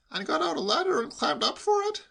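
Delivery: high-pitched probing question; incredulous nephew. A narrator's reading. Nephew cross-examining uncle, incredulous. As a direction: pile on the doubt in a high voice.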